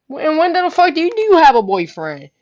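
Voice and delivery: loud, high demand that falls at the end; incredulous. Retold or imitated. Confronting cousin about her new boyfriend, incredulous. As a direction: stumble in, then burst out in disbelief.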